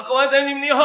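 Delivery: high, loud street-preacher's call; loud public greeting. Acted. Preacher demonstrating street evangelism with a loudspeaker, loud greeting. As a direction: hail a crowd at full voice. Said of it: "我大聲講".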